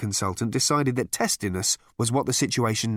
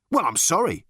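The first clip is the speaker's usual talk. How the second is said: higher-pitched, huffy apology; 7 semitones higher than usual. A narrator's reading. Interrupted management consultant to Ford, huffy. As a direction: take mild offence. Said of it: "said the man huffily".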